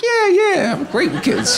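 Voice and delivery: laughing eager boast; overeager yes. Retold or imitated. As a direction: sell it way too hard. Eager yes to casting director.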